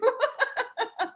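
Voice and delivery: high falling laugh; self-deprecating giggle. Real, not acted. Guest thanking friend for rescuing her, laughing.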